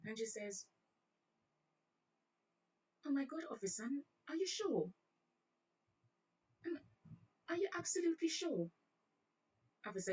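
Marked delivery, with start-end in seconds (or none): 3.1-8.7 high, soft mock-British disbelief; British accent. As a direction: question the officer in dainty put-on British. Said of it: "broke in a one British accent".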